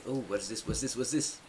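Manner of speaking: normal talking voice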